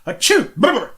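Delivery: high sneeze then a shaky brr; shaking sneeze. Retold or imitated. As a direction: sneeze and shake it off. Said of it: "he'd go".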